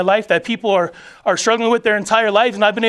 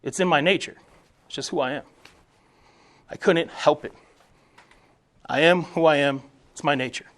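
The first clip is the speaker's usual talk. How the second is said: resigned scorpion; 6 semitones lower, 6 dB quieter than usual. Retold or imitated. Scorpion answering the dying frog, lower and softer, resigned. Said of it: "And the scorpion's reply was".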